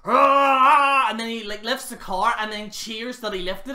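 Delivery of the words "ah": roaring yell. Retold or imitated. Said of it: "so he goes".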